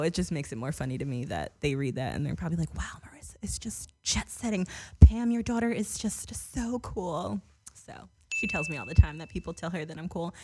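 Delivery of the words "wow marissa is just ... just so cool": lightly put-on, higher admiring voice; gushing relative. Voices relatives gushing about her.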